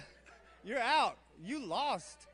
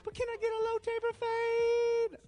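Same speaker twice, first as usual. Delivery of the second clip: very high, held falsetto line; mock falsetto; 13 semitones higher than usual. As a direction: squeak it out high and stretch the end. Comic high finish to slang recap.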